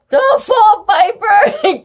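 high, loud worried call; cried out. Acted. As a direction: cry out to Piper. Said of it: "cried Sam".

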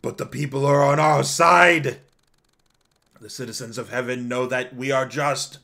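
loud, desperate acted protest; protesting councilor. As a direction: insist on being in the right, opening with a cry. Plays the councilor in the story.

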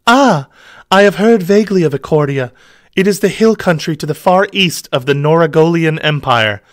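high, breathy-free damsel voice. Acted.

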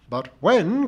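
high, loud, insistent complainer; aggrieved char lady. A narrator's reading. Missus Pringle fixes the narrator with a glittering eye, aggrieved.

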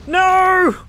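high, loud cry of defeat; anguished shout. Real, not acted. Beaten again in the game.

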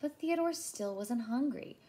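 ordinary delivery

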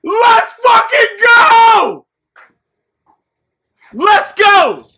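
loud drawn-out victory roar; frat bro. Real, not acted. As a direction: scream it out, thrilled. Pack opening hits [name].

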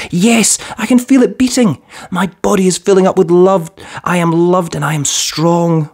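put-on little boy finding his heart full; happy child voice. A narrator's reading. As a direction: say it like a child discovering joy. Boy affirms love, child voice.

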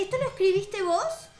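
normal talking voice

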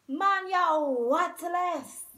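slow, loud reproach; scolding. A narrator's reading. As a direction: drag out the insult loudly. Zaki repeats scolding.

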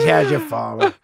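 ordinary talk, nothing marked

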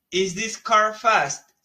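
slow, careful English question; teacher modelling a question slowly; slow model question. Shown as a demonstration. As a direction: lay each word out for learners.

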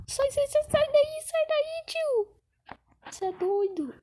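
high panicked yelling; being chased. Real, not acted. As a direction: shriek at the zombie to back off. Zombie on his tail.

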